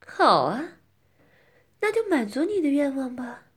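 loud coquettish reply; playful, teasing consent. Acted. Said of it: "我当时就骚气地说".

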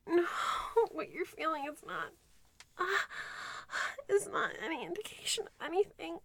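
ordinary talk

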